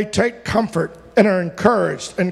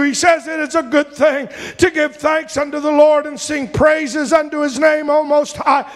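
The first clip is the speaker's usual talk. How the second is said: fervent preaching; 6 semitones higher than usual. Acted. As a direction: plead with the Lord before the church. Prayer and preaching at full force.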